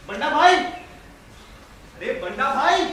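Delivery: calling out. Acted. Visitor at the house calling out for Banda bhai, calling out. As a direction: holler the name, lifting the end. Visitor's host summoned from outside.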